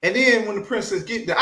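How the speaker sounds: ordinary talk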